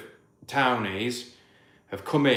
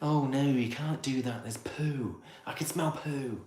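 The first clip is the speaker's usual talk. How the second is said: prissy, softer put-on whine; mock townie complaint; 8 dB quieter than usual. Imitating townies fussing about farm smells, mock prissy voice. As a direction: sneer at the squeamish newcomer.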